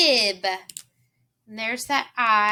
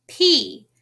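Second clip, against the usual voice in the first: normal talking voice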